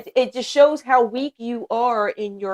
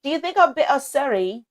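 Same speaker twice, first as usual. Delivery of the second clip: British accent; 3 semitones higher, 5 dB louder than usual. Shown as a demonstration. Teasing listeners who love it, put-on British accent. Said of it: "when I do the accent".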